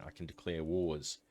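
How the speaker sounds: usual speaking voice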